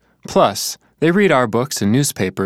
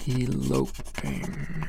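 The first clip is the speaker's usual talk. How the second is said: "eloping" slow, much softer and lower, spelled out while writing; drawn-out quiet word; 9 semitones lower, 11 dB quieter, much slower than usual. Shown as a demonstration. [name] writes the answer, murmuring "eloping" slowly. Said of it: "how is it written?".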